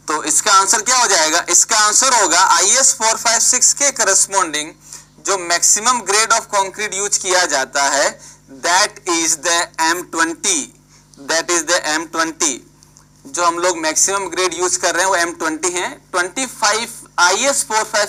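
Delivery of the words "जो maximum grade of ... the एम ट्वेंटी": slow dictation. Shown as a demonstration. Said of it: "teacher dictating for notes".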